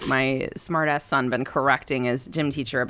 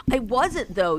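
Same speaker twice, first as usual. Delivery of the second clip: higher-pitched imitation of a child's denial; protesting kid; 7 semitones higher than usual. Son still denying to mother that he mumbled, protesting. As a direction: mimic a kid stubbornly contradicting.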